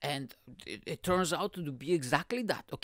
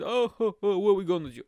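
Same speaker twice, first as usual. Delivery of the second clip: loud fake sobbing wail; mock crying; 3 semitones higher, 5 dB louder, faster than usual. Onlooker weeping for poor Mister Johnson, mock crying. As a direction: bawl it out, hammed up. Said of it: "I'm crying over there".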